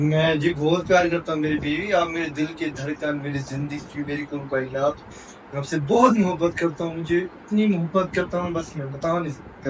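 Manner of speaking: loving confession. Pretend. Husband confesses love to his pillow wife, softly. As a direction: pour out love in a hush. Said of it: "बताओ उसे कितना प्यार करते हैं आप".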